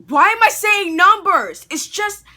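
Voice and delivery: high, loud, slowed groan at himself; exasperated self-scold. Real, not acted. Vlogger catching his own numbering slip, exasperated.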